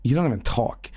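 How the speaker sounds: low unvoiced sneer; hushed contemptuous jab; scornful whisper. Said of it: "and he goes".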